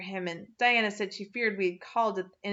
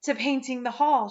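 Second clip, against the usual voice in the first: breathless gasp; 4 semitones higher, 4 dB louder than usual. A narrator's reading. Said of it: "she gasped".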